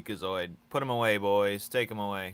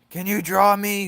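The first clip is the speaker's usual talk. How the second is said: mimicking a fan; 12 semitones higher, 13 dB louder than usual. Mocking viewers who beg for a drawing, in a squeaky high voice. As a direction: whine it like an eager fan begging. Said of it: "People be like".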